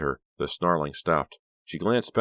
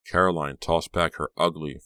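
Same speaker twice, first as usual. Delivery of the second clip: usual speaking voice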